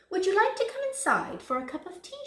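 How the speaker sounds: high, quick, loud offer; eager invitation. A narrator's reading. Missus Duck asking wolf in for tea, eager.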